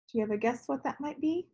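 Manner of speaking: plain reading voice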